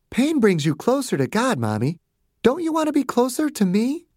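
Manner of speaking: put-on high little-girl voice; child cult leader, coaxing. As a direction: coax Mommy like a small preacher.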